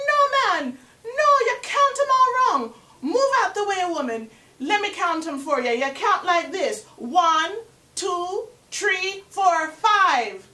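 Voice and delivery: the angry spider shoves Dove aside and shouts; furious Anansi. Acted. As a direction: shout her off the heap in a rage. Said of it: "Anansi's so furious".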